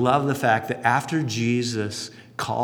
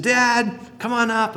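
put-on boy calling; 10 semitones higher, 4 dB louder, slower than usual. Boy calling father again.

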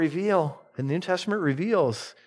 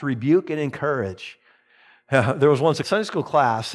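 ordinary talk, nothing marked

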